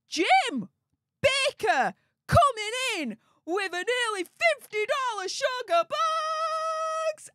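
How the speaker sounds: high hype call, last word held long; sing-song celebratory announcement; excited donation shout-out. Real, not acted. Hyping a viewer's big donation.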